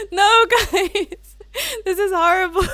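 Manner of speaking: high, loud, near tears from laughing; laughing hard. Real, not acted. Streamer begging chat, laughing to tears. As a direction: lose it laughing. Laughing at her own gaffe.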